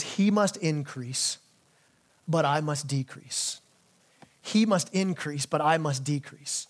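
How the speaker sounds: slow, low, measured recitation; solemn key verse. Real, not acted. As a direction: let each word land slowly and softly. Key verse quoted, then repeated.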